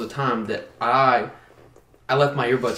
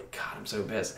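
muttered much softer and lower, fuming; muttered to self; 6 semitones lower, 15 dB quieter than usual. Retold or imitated. Walking away from the gym guy, muttering angrily. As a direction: grumble it under your breath.

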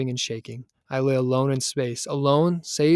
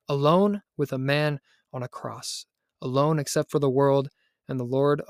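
ordinary delivery

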